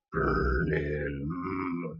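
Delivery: low, drawn-out muscleman rumble; low beastly growl ending in a hum; beast of a man. Acted. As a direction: play the hulking lifter, low and soft. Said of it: "this beast of a man".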